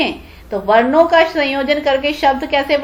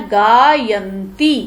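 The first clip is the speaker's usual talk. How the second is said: drawn-out answer; 3 semitones lower, much slower than usual. Shown as a demonstration. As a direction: stretch गायंती out very slowly. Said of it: "तो क्या, क्या बना?".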